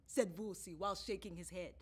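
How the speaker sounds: usual speaking voice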